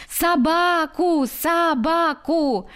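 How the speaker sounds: put-on high, slow wailing child's cry of the word; a spoiled boy howling for the dog; bawling child. A narrator's reading. Spoiled boy wails at the organ-grinder, high and drawn out. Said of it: "залился громче прежнего мальчик".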